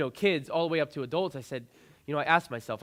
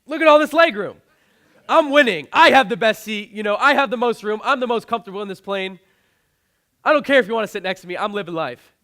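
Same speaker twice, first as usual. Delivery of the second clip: much higher and louder, bursting with glee; excited self-talk; 7 semitones higher, 14 dB louder than usual. Retold or imitated. Talking himself up on the crowded plane, loud excited glee. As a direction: shout it like you just realized you won. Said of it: "I laughed, you know, and I was like".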